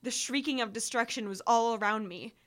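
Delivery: plain reading voice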